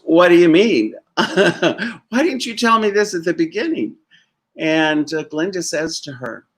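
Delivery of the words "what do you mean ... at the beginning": loud, laughing outburst of disbelief; Dorothy finally learning she could have gone home. Retold or imitated. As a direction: blurt it out through a laugh, stunned. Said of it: "and goes".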